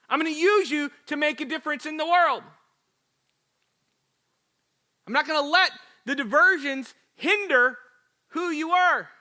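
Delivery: loud, rousing pulpit preaching; preaching at full force. Acted. Pastor rousing the church about God's plan, full-force preaching. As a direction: preach it hard to the congregation.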